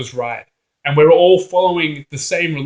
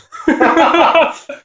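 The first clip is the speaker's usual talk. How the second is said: loud high burst of laughter; 11 semitones higher, 6 dB louder than usual. Real, not acted. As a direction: crack up, high. Laughing at own joke.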